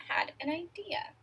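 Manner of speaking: hushed and unvoiced, like a secret; whisper. A narrator's reading. Reading to kids, whispers Floyd's sneaky idea. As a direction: breathe it like a secret.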